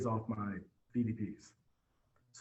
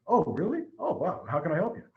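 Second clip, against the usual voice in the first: retold surprise; 5 semitones higher, 4 dB louder than usual. Salesman reacts to cash.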